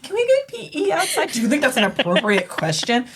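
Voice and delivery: pointed teacher rebuke with a laugh; mock scolding. Teacher replaying her rebuke to the boy, mock scolding. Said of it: "'cause I'm like".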